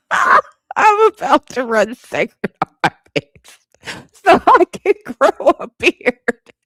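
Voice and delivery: high, slow laughing wail; laughing through tears. Real, not acted. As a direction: cackle it out as if crying. Laughing-crying about beard.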